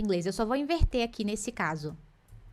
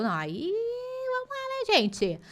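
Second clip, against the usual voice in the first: playful exhortation; 9 semitones higher, slower than usual. Real, not acted. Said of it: "teacher scolding playfully".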